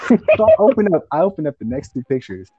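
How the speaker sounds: ordinary talk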